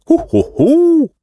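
slow, very high hooting; drawn-out jubilant "hu, hu, hu"; rousing cheer. A narrator's reading. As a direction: hoot with noisy delight. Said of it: "uradowali się rozgłośnie".

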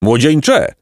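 an understated roar of reproach from a donkey; mild donkey roar. A narrator's reading. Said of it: "ryknął Osioł".

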